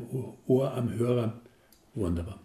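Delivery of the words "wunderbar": usual speaking voice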